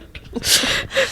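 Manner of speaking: squealing, airless giggle; helpless high laughter; high breathless laugh. Real, not acted. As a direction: laugh till it goes silent. Laughing at mom's worry.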